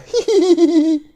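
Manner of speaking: high loud burst of laughter. Real, not acted. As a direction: crack up at the naughty prince. Laughing at naughty prince.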